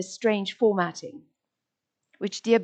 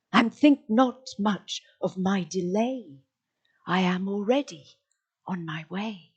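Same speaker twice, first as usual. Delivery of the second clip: poem recitation; slower than usual. Acted. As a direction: let each line fall gently with pauses. Recites an elegy.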